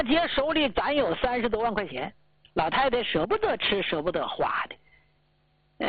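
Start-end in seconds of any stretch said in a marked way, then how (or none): 3.8-5.9 whispered. Real, not acted. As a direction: drop to a hush, almost to herself. Sad aside about savings. (压低声音轻轻说)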